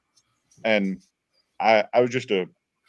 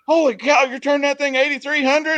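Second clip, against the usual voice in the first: much higher, louder disbelieving cry; dad's shocked exclamation; 4 dB louder than usual. Retold or imitated. Dad reacting to the eighty-three hundred reading, shocked. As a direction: act out dad's jaw-dropping surprise. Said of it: "And he goes".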